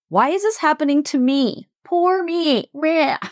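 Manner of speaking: hammed-up high, whiny poor-me voice; self-pitying whine. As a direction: whine it like a mock victim, breaking into a laugh. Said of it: "self-pity and whining".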